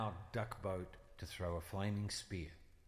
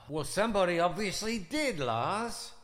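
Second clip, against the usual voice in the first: accusing; 7 semitones higher, 7 dB louder than usual. Acted. Gasp then accusing shout.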